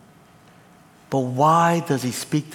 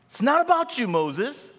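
preached rebuke; 11 semitones higher than usual. Acted.

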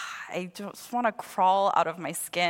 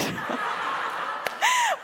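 high laugh. Real, not acted. Laughing at own confession.

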